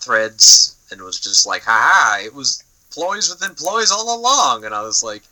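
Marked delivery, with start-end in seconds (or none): 1.6-4.6 gloating villain reveal. Retold or imitated.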